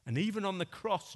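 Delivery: usual speaking voice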